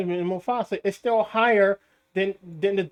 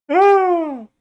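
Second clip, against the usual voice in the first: loud high gasp of disbelief; high laughing cry; 12 semitones higher, 10 dB louder than usual. Real, not acted. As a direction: let out a high incredulous cry.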